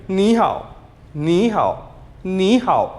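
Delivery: नी हाओ said quickly and naturally; quick everyday ni hao; at normal speed. Shown as a demonstration. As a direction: run नी हाओ at conversational pace. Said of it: "speed में".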